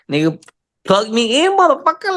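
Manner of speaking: soaring high, pumped; mock hyped rapper cheer. Hyped self-quote.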